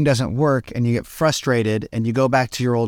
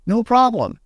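breezy no problem; 11 semitones higher than usual. Retold or imitated. Relaxed self brushes off daily problems, high and breezy. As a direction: toss it off brightly. Carefree inner voice.

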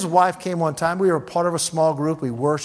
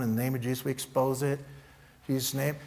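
calm quiet command; 2 semitones lower, 7 dB quieter than usual. Shown as a demonstration. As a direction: state it quietly, no jumping around. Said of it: "just kinda in that tone".

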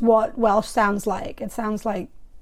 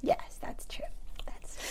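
unvoiced whisper, much softer; hushed agreement; 15 dB quieter than usual. Real, not acted. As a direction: whisper it conspiratorially.

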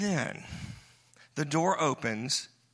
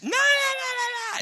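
preacher imitating a screaming little girl. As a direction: squeal no like a wild five-year-old. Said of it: "running around the room screaming".

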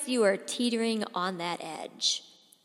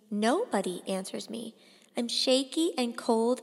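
plain reading voice

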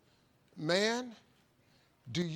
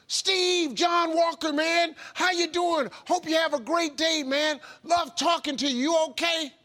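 much higher, louder, bursting with energy; over-the-top cheerful; 9 semitones higher, 6 dB louder than usual. Retold or imitated. John waking Steve with a call, booming and cheerful. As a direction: boom it out like a hype man at dawn. Impersonating energetic morning caller.

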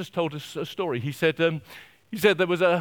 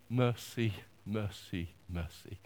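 fading repetition; 8 semitones lower, 7 dB quieter than usual. Acted. Repeated word fading away.

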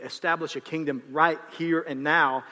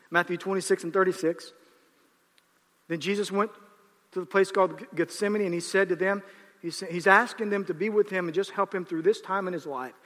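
ordinary talk

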